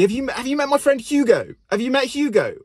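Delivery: very high-pitched, falling mock boast; mock posh name-dropper. Colleague name-dropping his friend Hugo, high-pitched and mocking. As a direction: send up a braying colleague.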